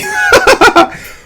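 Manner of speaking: very high forced cackle; fake laugh. Mocking a wasteful signing with a shrill fake laugh. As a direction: burst out a hammed-up fake laugh. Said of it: "fake laugh".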